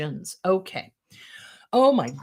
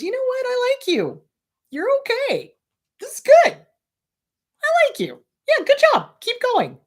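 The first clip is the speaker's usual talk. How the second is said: much higher, sweet encouraging voice; kind inner voice; 5 dB louder than usual. Pretend. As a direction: cheer yourself on like a gentle little voice. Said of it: "that quiet little voice in your head".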